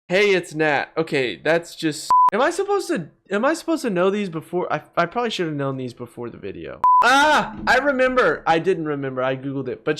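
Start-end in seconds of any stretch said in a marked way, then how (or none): none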